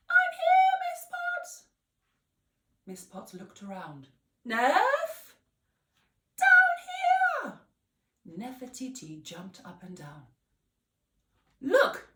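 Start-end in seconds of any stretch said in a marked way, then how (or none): 0.1-1.7 very high, squeaky, small voice; a thumb-sized girl calling up; tiny voice. Acted. 4.4-5.3 high, loud, searching call; puzzled call. Acted. Miss Potts looking around. 6.4-7.6 very high, loud squeaky shout; tiny shout. Acted. 11.6-12.1 high, loud excited look; child spotting something odd; pointing out. Acted. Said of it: "said Kofi".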